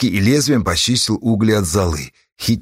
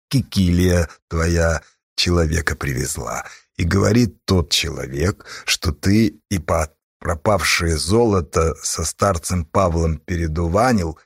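low, effortful delivery; reluctant, forced out; 7 semitones lower than usual. A narrator's reading. Aged-looking Yakim tells Ipat of the accusation, reluctantly. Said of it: "будто через силу сказал".

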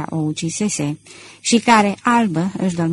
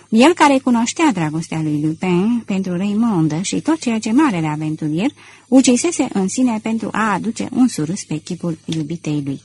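usual speaking voice

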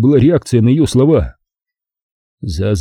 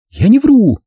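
high, loud, defiant denial; defiant retort; 12 semitones higher, 5 dB louder than usual. A narrator's reading. Zaza denies lying to Vakha's face, defiant. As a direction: throw it back boldly, high-pitched and loud. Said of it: "дерзко бросила она".

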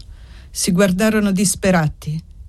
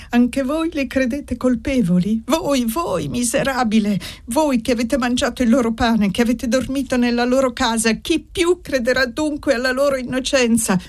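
crying out; 7 semitones higher than usual. A narrator's reading. Annesa rebuking the old servant, crying out in high outrage. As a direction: hurl it at him as an accusation. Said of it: "cominciò a gridare".

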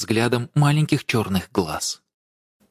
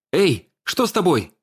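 higher, worried call; alarmed call; 7 semitones higher than usual. A narrator's reading. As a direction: shake her awake anxiously. Shakes passenger's shoulder.

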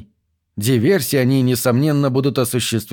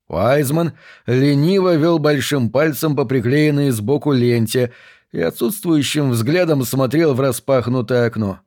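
ordinary delivery

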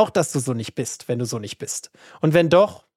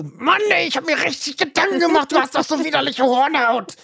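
offended giver; 8 semitones higher than usual. Pretend. Acting offended gift giver.

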